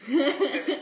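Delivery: laughing at own bad luck. Real, not acted. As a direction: giggle through the cut-off words. Laughter breaks into the line.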